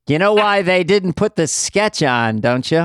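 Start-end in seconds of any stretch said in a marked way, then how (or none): none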